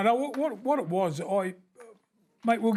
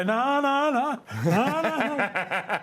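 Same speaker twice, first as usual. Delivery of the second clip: mock refusal; 7 semitones higher, much slower than usual. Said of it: "He'd go".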